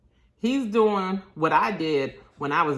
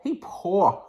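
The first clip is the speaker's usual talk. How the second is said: laughing; 3 semitones higher, 4 dB louder than usual. Real, not acted. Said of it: "that's what I mean by poor".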